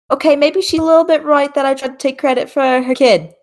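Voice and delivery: put-on high, fast, sassy older woman; high boomer grandma. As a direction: own it with cheeky high sass. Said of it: "Cat is like".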